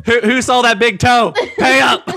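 giggling while teasing; high, laughing tease. Real, not acted. Teasing about toe, laughing.

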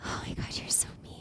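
hushed, voiceless whisper; whispered reproach. Real, not acted. Co-host teased about not having eaten, whispered reproach. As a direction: whisper it like you've been put on the spot.

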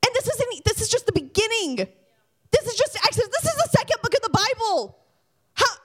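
high-pitched, urgent preacher delivery; fervent preaching. Real, not acted. Preacher marvels to youth group at God's work, fervent and high.

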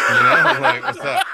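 high laughing rasp put on; ancient lich voice. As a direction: croak it out like an undead lich. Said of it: "give me your lich voice".

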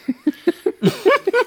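bursts into a high squeal of laughter; shrieking giggle. Real, not acted.

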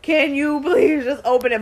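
retold impatience. Daughters waiting on mommy to open gift, mock impatience.

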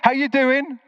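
high, cheery mock call across the camp; friendly shout. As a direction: call out across the campsite, half-acted. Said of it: "he shouts across to me".

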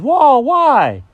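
loud, high startled reaction; cartoon dog taken aback; surprised dog. Alfred startled by Jenkins.

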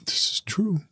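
breathy playful whisper. Real, not acted. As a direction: whisper it like a secret. Agreeing in a playful aside.

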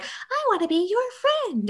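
very high, sweet doll voice; high innocent toy voice; creepy doll voice. Retold or imitated. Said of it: "when he was just like".

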